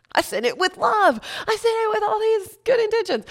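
squeaky, loud complaint of injustice; mock wail. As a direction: wail it like a wronged child. Mock wail over bounced rent.

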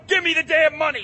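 high loud furious demand; angry shout. Acted. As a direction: yell it in a rage. Robber shouts at clerk.

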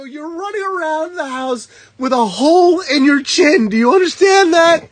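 retold scolding. Telling him about the hole in his chin, exasperated scolding. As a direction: scold in a high, insistent voice.

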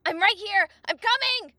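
high, loud, frantic shouting; urgent exclamation. A narrator's reading. Link to his trapped fairy, urgent shouting. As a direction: shout reassurance while racing to the rescue. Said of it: "Link exclaimed".

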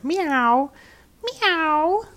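high rising meows; cats crying meow. A narrator's reading. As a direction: mew like the cat procession. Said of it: "crying all together".

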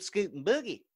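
high, loud Southern twang; Southern accent. Pretend. Playful Southern phrase.

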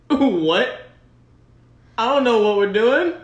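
loud, high, rising puzzled protest; mock bewilderment. Acts out puzzled reply to team.

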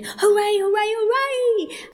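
high, loud, drawn-out cheering; jubilant cry. A narrator's reading. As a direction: keep cheering in sheer delight. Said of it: "Suddenly the rat cried".

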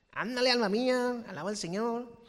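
put-on Mexican accent. Preacher telling his soul to praise, in mock Mexican accent. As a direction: cheer your soul on Mexican style. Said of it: "En el acento que tú quieras".

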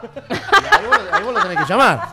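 laughs through the words, high and loud; laughing. Real, not acted. Telling his friends about Kiko, laughing high and loud. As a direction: crack up while telling it.

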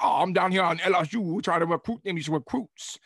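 Foghorn Leghorn. Retold or imitated. Mocking the LSU coach as a loud cartoon rooster. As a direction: ham up a blustering cartoon rooster. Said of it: "Foghorn Leghorn".

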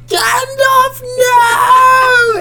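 high, loud cry of no; retold anguished cry. Frodo crying out as Gandalf falls, anguished yell. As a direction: wail it as the friend falls. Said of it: "And Frodo's like".

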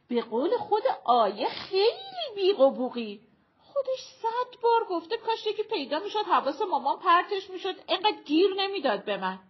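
high, loud, rushing to the end; teasing, animated friend. Acted. Shirin telling her friend what Ayeh herself said, animated. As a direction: push the point in a lively high voice.

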